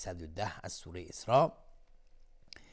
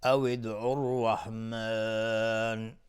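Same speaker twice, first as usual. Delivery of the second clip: recited demo; much slower than usual. Shown as a demonstration. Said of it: "اگر اینجا توقف کردیم".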